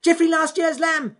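very high, loud rabbit yell; shout at top of voice. Acted. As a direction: call out urgently as loud as you can. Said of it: "he shouted at the top of his voice".

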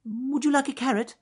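very high, squeaky bunny question; high, loud little rabbit; helpful rabbit. A narrator's reading. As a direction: offer it brightly in a tiny rabbit voice. Rabbit offers comfort.

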